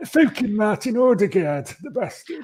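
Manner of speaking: high, slow, hammed-up northern English; northern English grumble, dropping away at the end; badly faked northern accent; terrible northern accent. Shown as a demonstration. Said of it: "Try it again in northern accent".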